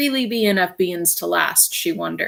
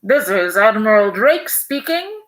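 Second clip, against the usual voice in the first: louder, announcer-like radio broadcast; radio voice from afar; 3 semitones higher, 4 dB louder than usual. Acted. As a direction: call out over a crackling radio from the South Pole. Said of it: "a faint voice floated out".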